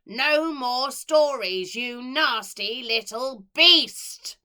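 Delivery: loud, slow furious bellow; raging. Acted. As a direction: roar it, beating each word. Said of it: "raged the woman".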